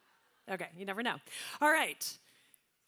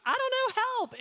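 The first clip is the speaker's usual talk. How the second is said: put-on higher, helpless Southern accent; 10 semitones higher than usual. Auctioneer mocking herself to New Yorkers, helpless Southern accent. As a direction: play dumb in a Southern accent. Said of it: "I do this with a Southern accent".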